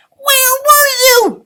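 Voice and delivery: loud high leprechaun yell. Acted. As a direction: burst out at the lost dog. Leprechaun bursting out at dog.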